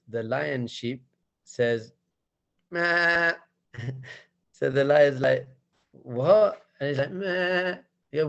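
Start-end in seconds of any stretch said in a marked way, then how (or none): 2.7-3.3 high-pitched sheep bleat. Acted. Cub answering the lion, high sheep bleat. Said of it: "making sheep noises". 5.9-6.6 loud, baffled exclamation; baffled lion. Acted. Lion hearing the cub bleat, loudly baffled. 7.1-7.8 high-pitched sheep bleat. Acted. Cub answering the lion, high sheep bleat. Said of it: "making sheep noises".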